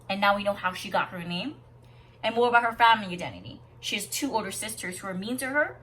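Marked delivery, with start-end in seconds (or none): none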